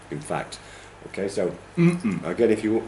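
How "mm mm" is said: two-beat hum, strong then weak; hummed word. Shown as a demonstration. Teacher highlighting word stress for learners, hummed strong-weak. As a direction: hum the stress pattern of random with lips closed. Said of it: "if you wanna hum it".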